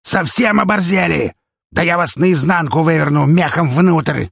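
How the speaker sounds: hoarse furious roar, high and loud; feral wasteland raider. A narrator's reading. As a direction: threaten like a rabid thug. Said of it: "хрипло заорал".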